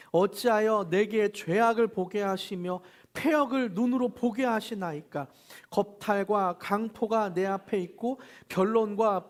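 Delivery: slow, loud, measured reading of the verse; solemn group recitation of scripture; scripture reading aloud. Acted. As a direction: read it out together with the congregation, slowly and firmly. Leads group Bible reading.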